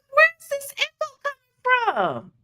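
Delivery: baffled. Retold or imitated. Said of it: "I'm like".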